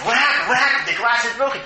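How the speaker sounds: high, loud cries of alarm; loud high-pitched mock panic; alarmed bystanders. Acted alarmed passers-by in the story.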